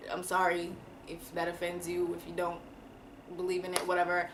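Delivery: usual speaking voice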